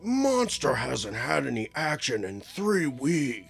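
slow, drawn-out monster voice; Keanu Reeves's monster shtick. Retold or imitated. Mocking a husband wheedling his wife, slow monster voice. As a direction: stretch it out as a pleading play monster. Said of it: "he's like".

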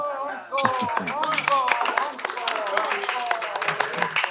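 hearty laugh. Acted. Laughing with Martha in the bar.

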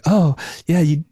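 half-hearted praise, acted out; pitying. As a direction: imitate someone giving a pity pat on the back. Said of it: "like, the pity kind".

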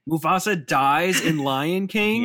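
slow, high and loud fake astonishment; drawn-out mock gasp of disbelief; mock shock. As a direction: pretend to be stunned by the spoiler.

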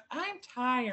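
whiny tired. Retold or imitated. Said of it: "she goes".

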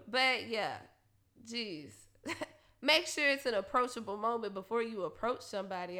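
ordinary talk, nothing marked

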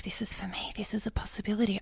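hushed, breathless inner voice; excited whisper. Retold or imitated. Teacher hearing the radio ad, whispering to herself. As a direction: whisper it like a secret hope.

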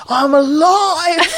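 high, loud, slow joyful yell; triumphant cry. Retold or imitated. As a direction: shout it like you survived. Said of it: "he stood up and we went".

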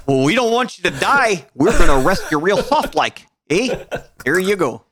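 hammed-up Canadian accent. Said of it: "come in with a Canadian accent".

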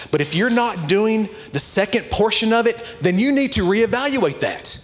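high-pitched, emphatic sermon delivery; fired-up preaching. Real, not acted. Preaches with raised voice.

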